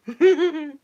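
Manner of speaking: loud evil cackle; evil laugh. Acted. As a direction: cackle like a villain.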